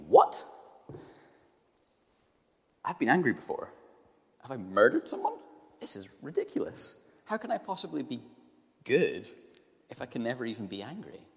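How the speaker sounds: put-on a kid protesting that it's ridiculous; indignant disbelief. Child reacting to passage, indignant.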